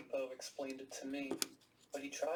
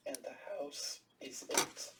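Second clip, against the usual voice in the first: cryptic whisper; slower than usual. A narrator's reading. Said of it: "he pointed up the stairs".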